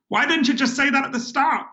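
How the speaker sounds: high, fed-up waitress voice; waitress finally understanding the order; exasperated waitress. Retold or imitated. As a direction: play her exasperated, pitch up.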